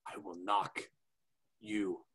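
soft, low mock Dutch accent, halting; quiet menace in a put-on Dutch accent; Alistair. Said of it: "now we get a Dutch accent".

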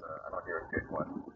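ordinary talk

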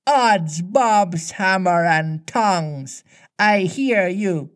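mimicking the pirate captain, high and slow; Hook. A narrator's reading. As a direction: answer as a mock captain, stretching the oath. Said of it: "immediately answered in Hook's voice".

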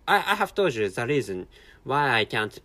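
normal talking voice

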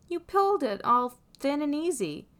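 loud, high-pitched protest; exasperated exclamation. A narrator's reading. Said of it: "Louis exclaims".

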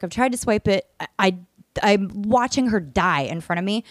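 ordinary talk

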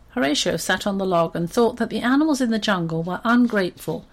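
usual speaking voice

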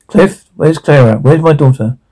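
ordinary delivery

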